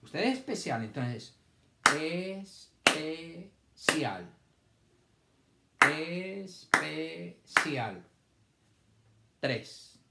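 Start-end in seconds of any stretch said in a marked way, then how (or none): 1.9-8.0 very slow, es-pe-cial split into syllables; sounded out syllable by syllable. Shown as a demonstration. Teacher reading aloud to young pupils, syllable by syllable. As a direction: stretch each syllable apart for the children. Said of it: "Leer es hacer los sonidos todos pegaditos".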